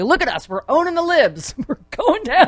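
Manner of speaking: high, laughing gloating voice; gleeful MAGA passenger. Retold or imitated. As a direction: cheer gleefully as the plane crashes. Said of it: "half the people on the plane are like".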